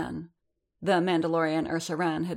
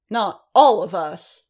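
called out; 3 semitones higher, 9 dB louder than usual. A narrator's reading. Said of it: "another voice called".

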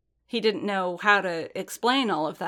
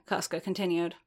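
plain reading voice

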